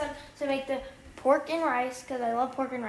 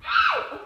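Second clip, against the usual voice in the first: sudden squeak of pain; sharp high yelp of pain; 6 dB louder than usual. Real, not acted. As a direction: yelp as the finger burns. Burns finger on hot pan.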